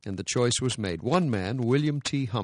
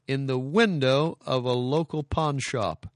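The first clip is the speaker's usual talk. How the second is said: ordinary delivery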